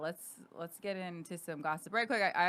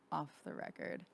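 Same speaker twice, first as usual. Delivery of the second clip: whispered aside; 3 semitones lower, 8 dB quieter than usual. Real, not acted. Secret saved for after recording.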